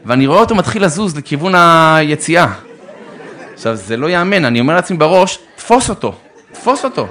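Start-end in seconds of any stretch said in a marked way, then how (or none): 5.5-7.1 higher, urgent self-order to grab the falling laptop; urgent inner command. Retold or imitated. Father urging himself to catch the laptop, higher and urgent. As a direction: bark the order at yourself, pitched up. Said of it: "אני נותן לעצמי פקודה".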